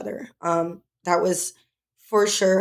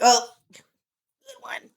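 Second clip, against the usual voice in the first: drawn-out, higher mocking "oh"; sarcastic dismissal; 4 semitones higher, slower than usual. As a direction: scoff as if rolling your eyes. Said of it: "roll our eyes and be like".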